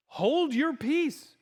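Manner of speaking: slow, high, commanding order; aggressive command. Acted. Jesus silencing the wind and sea, slow aggressive command. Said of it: "It's so aggressive".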